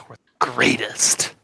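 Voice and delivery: proud believer, drop to a hush; zealous Steven. Acted. Steven on the chosen.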